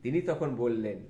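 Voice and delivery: ordinary delivery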